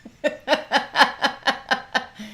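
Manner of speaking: loud burst of laughter; laughing. Real, not acted. Laughing at the tease.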